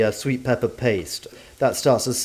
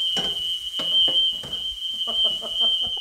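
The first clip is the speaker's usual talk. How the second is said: high breathy laugh at the blaring alarm; real burst of laughter; 4 dB quieter than usual. Real, not acted. Author laughing after setting off the fire alarm, real laughter. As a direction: crack up helplessly.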